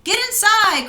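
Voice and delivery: high urgent command; urgent cry. Acted. Nickowitz urging his siblings inside, urgent and high. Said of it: "cried Nickowitz".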